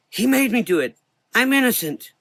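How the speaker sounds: high, loud desperate plea; pleading. Acted. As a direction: beg to be believed. Boy pleading, voiced high.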